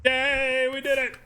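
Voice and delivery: high, loud yay of triumph; happy cheer. Real, not acted. Streamer celebrating the win with chat, loud and high. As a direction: cheer it like you just won.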